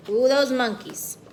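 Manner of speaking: ordinary delivery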